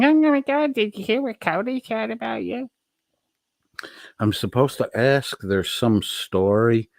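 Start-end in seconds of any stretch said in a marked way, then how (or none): 0.0-2.7 high squealing gossip voice; gossipy squeal. Retold or imitated. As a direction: gasp it like a gossiping friend. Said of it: "run to you going".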